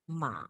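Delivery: MA dipped low, then up a bit; dipping third tone. Shown as a demonstration. Teacher modelling the third tone, down then up. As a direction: scoop MA down and back up.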